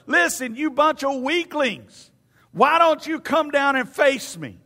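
loud, high, drawn-out taunting yell; hollered trash talk. Retold or imitated. Goliath taunting the Israelite army to send a man, hollering. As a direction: holler it slowly like a giant daring weaklings. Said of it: "He would start hollering and yelling".

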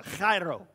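chairo with a throaty phlegmy start; Greek pronunciation. Shown as a demonstration. Pastor demonstrates the Greek word chairo with throaty phlegm. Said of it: "You gotta get a little bit of that phlegm going on".

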